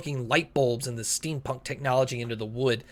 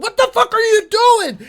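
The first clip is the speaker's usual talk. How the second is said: high loud demanding shout; angry green guy shout; 7 dB louder than usual. Acted. Green guy grabbing a shoulder mid-brawl, shouting high. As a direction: snap it at the troublemaker.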